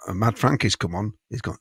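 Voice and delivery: ordinary talk, nothing marked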